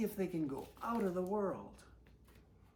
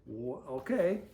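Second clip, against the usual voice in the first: ordinary delivery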